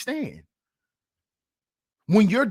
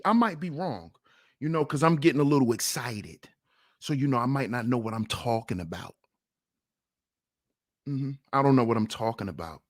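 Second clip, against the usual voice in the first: lower, fast, dry sarcasm; sarcastic false modesty; 8 semitones lower, 4 dB quieter, faster than usual. Acted. Streamer feigning doubt to his critics, dryly sarcastic. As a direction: pretend to doubt yourself, mockingly.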